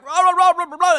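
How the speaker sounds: loud high mock ranting noise; Tim Scott. Host mimics the cut-off mic, loud jabbering. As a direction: imitate a muffled politician yelling gibberish over everyone. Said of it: "hear the ambient sound of Tim Scott going".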